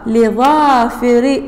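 لظافر said slowly, kasra under the lam and the ra; slow careful reading. Shown as a demonstration. Teacher to pupils, reading لظافر slowly for dictation. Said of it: "حرف جر".

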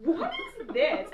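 high, slow, laughing what-is-this; laughing disbelief. Retold or imitated. Reading a billboard to herself, high amused disbelief. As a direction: gasp it out in amused shock. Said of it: "And I was like".

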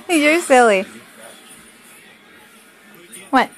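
normal talking voice

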